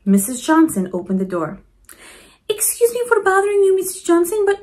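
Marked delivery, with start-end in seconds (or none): 2.5-4.6 higher, brighter voice for Mama Provi; lightly voiced grandmotherly neighbor asking. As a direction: voice the kind old lady asking politely. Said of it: "Mama Provi asked".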